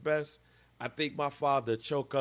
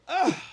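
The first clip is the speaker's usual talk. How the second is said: high, loud mock groan; 12 semitones higher, 6 dB louder than usual. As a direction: groan as you get up hurt. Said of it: "Mark Sanchez get up like".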